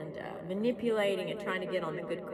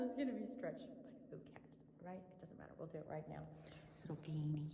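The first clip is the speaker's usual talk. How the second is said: quiet aside; 5 semitones lower, 14 dB quieter, faster than usual. Real, not acted. Quiet aside while fixing video.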